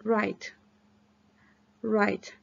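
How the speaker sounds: write modelled alone, starting on the R; silent W. Shown as a demonstration. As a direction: leave the W out of write, like "rite". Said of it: "no se pronuncia". (write sin pronunciar la W, como "rait")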